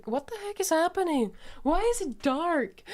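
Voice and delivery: loud, high-pitched disbelief; shocked outburst. Real, not acted. Player reacting to the dark screen, shocked. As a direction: exclaim it high and loud, baffled.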